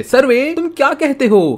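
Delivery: calling out. Acted. Calling out to the survey. (बहुत ऊँची आवाज़ में पुकारना)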